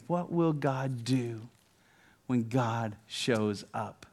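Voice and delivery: slow, low, hushed question; drawn-out, reverent pulpit question; solemn wonder. Real, not acted. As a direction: ask it softly with weighty pauses. Solemn rhetorical question after storm calms.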